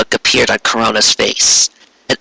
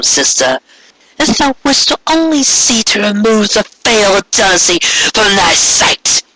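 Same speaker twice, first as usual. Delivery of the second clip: Corona, pleading yet imperious; 6 semitones higher than usual. Acted. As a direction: coax her sister to submit. Corona pleads then claims rule.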